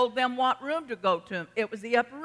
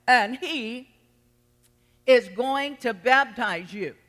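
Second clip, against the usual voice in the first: loud, driving preacher's cry; full-force preaching; 4 semitones higher, 7 dB louder than usual. Acted. Preacher to his flock, fervent loud preaching. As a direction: rouse the congregation.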